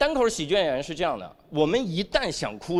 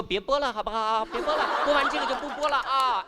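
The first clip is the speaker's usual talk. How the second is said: mock pleading, pitched up; 8 semitones higher than usual. Parent begging a phone to stop playing, mock pleading. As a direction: plead in a higher voice. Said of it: "跟手机说".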